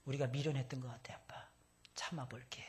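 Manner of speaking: whisper. Retold or imitated. Daughter's hushed apology voiced.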